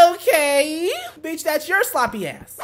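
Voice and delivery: high, shouted comeback with drawn-out words; loud mock outrage. Hitting back at the friend's one-night-stand excuse, loudly outraged. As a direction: holler it, dragging the words out.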